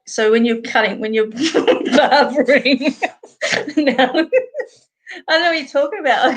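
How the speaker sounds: words broken up by giggles; retelling her request to the professor, giggling; laughing through a quote. Retold or imitated. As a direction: quote herself while cracking up. Quoting herself to the professor.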